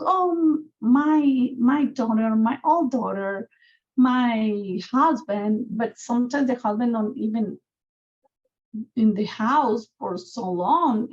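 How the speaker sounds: ordinary talk, nothing marked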